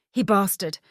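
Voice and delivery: He bastard with bastard punched out; emphasis on last word. A narrator's reading. Helena telling Angus about the ex, stressing bastard. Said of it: "putting extra emphasis on the last word".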